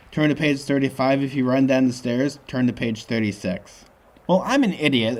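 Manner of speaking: ordinary delivery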